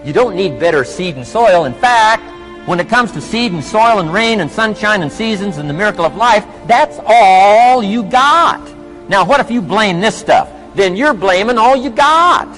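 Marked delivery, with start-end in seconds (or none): 1.7-12.5 booming motivational speech. Acted. Motivational speaker addressing an audience.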